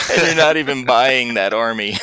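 loud, amused jab between laughs; laughing tease. Real, not acted. Host ribbing his co-host about an army, laughing. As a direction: tease it loudly through real laughter.

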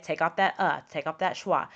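normal talking voice

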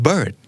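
BIRD with the middle R sounded; American-style BIRD, clear r-sound. Shown as a demonstration. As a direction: curl the R before the D in BIRD. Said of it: "มันจะมี R ที่อยู่กลางคำด้วย".

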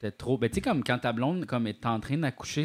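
normal talking voice

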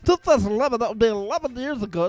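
mock radio DJ. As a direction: send up a cheesy radio DJ. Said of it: "even some of them guys are hokey".

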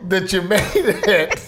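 sweetly fake-polite little boy; imitating son. Mother mimicking her son's polite thanks, high and laughing. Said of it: "He goes".

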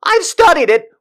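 high, loud exasperated rant; worked-up shouting, voice climbing; little rant. Acted. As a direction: rail at the audience in comic fury. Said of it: "have to do a little rant".

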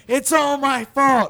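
put-on high, loud, slow kid wail; hammed-up sobbing confession; mock wailing. Little boy wailing to his dad, mock sobbing. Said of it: "I'm crying".

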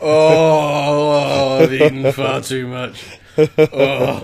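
loud, drawn-out groan of fullness; overfed groaning T-Rex. Acted. Podcaster voices a T-Rex after a huge meal, groaning. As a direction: groan it like a stuffed dinosaur.

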